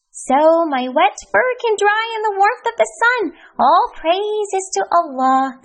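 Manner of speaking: high, lightly bright exclamation; a mild cheerful exclaim. Acted. Hamdi finding good in walking home, mildly bright exclaim. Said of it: "exclaimed Happy Hamdi".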